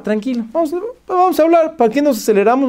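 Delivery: very high-pitched appeasing voice; hurried placating. Retold or imitated. Owner calming an irate customer, anxiously placating. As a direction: talk someone down in a flustered high voice.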